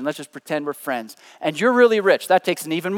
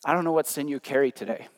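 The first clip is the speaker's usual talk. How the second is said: ordinary talk, nothing marked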